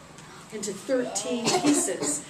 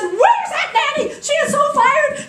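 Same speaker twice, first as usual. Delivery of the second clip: high, loud, slow furious yell; outraged queen shout; 11 dB louder, slower than usual. Acted. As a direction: shriek it like an indignant queen.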